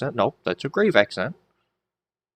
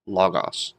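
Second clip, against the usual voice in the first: "logos" with LO stressed, a falling end; stress on first syllable; 3 semitones lower than usual. Shown as a demonstration. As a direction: lean on the LO of "logos". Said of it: "make sure the accent's on that first syllable".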